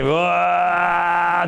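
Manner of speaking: a long stretched-out awed "whoa"; slow exaggerated gasp of wonder; drawn-out amazed wow. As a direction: marvel at the rope's length, dragging it out. Preacher showing long rope.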